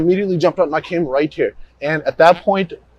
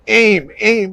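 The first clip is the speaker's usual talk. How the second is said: higher, louder urgent self-order; retold self-command; 6 semitones higher, 3 dB louder than usual. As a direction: urge yourself on out loud. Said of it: "in my head, I immediately said".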